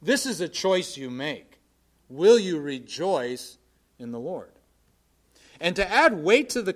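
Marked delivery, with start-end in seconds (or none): 2.1-4.5 slow question. Real, not acted. Preacher slow rhetorical question.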